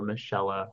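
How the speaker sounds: "Michella" said softly as mih-SHELL-uh; "Michella" with sh in the middle, tentative variant; alternative pronunciation. Shown as a demonstration. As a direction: offer "Michella" with a sh sound. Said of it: "however they're gonna pronounce it".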